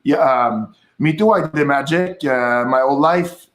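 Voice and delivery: Quebec-accented English; French-Canadian accent in English. Shown as a demonstration. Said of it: "the French Canadians would be like".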